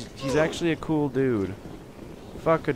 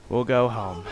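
usual speaking voice

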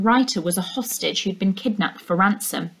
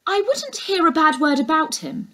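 higher, syrupy housekeeper voice; falsely loyal housekeeper; 8 semitones higher than usual. A narrator's reading. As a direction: sound devoted but sly.

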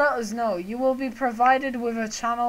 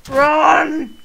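sudden loud panicked yell of Run; panicked shout; 5 semitones higher, 12 dB louder than usual. Real, not acted. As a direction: shout it as danger hits in the game. Panic in game, yelling.

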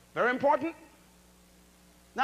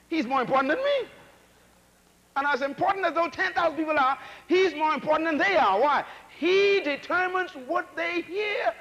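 louder and higher, building to a shout; rising preaching shout; 7 semitones higher, 4 dB louder than usual. Real, not acted. Preacher hammering the interpreter's importance, building shout. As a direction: drive it up till you're shouting.

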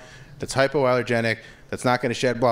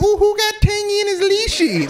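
put-on high sing-song baby talk; baby talk to dog; 4 dB louder than usual. Owner cooing at his tangled dog, high baby talk. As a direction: coo at the tangled puppy, way up high. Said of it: "I'm still like".

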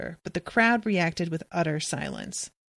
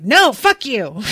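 louder, slow, blunt refusal; defiant refusal; 4 semitones higher, 7 dB louder, slower than usual. Retold or imitated. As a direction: spit it back at the elder. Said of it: "the little girl's like".

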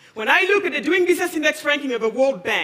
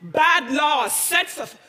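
drawn out, each word weighted; slow scornful verdict; much slower than usual. Real, not acted. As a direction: spell out the blame slowly. Closing condemnation of laws.

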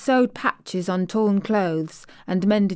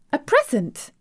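"present" rising high in surprise, a startled question; surprised exclamation; 8 semitones higher, 3 dB louder than usual. Acted. Girl amazed at the maid's gift, surprised high "A present?". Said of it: "exclaimed Mistress Mary".

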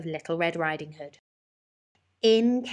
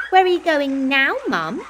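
put-on child's voice; 8 semitones higher, 8 dB louder than usual. A narrator's reading. Child asks mother.

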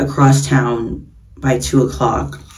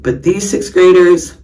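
ordinary talk, nothing marked

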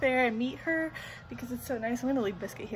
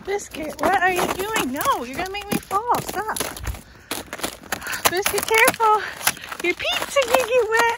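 scolding the dog; 9 semitones higher, 8 dB louder than usual. Real, not acted. Scolding slipping dog on ice.

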